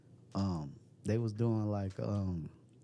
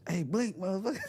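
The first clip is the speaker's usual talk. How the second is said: excited teasing shout; 12 semitones higher, 4 dB louder than usual. Real, not acted.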